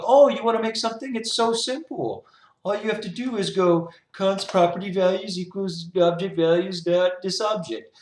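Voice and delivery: high, loud sing-song parody of a cheery tutorial; mocking tutorial voice. Coder mocking web tutorials, high loud sarcastic sing-song. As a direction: sneer it like a smug know-it-all tutorial. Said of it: "every freaking tutorial says the same thing".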